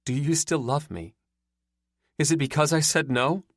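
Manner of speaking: tearful, pleading question. A narrator's reading. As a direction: ask it close to tears. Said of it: "Her eyes glossed over".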